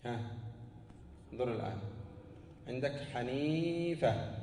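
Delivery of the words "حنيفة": حنيفة with the ī held very long: "ḥanīīīfa"; drawn-out long vowel. Shown as a demonstration. Teacher showing students the ya to delete, حنيفة stretched on ī. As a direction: stretch the middle of حنيفة slowly.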